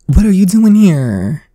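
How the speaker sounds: high, loud, startled cry; shocked high exclamation. Acted. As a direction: gasp it out as the stunned girl at her party. Voicing the game character's line.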